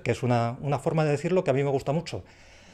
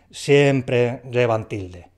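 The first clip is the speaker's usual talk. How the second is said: slow emphatic rule; much slower than usual. Shown as a demonstration. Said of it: "rule stated".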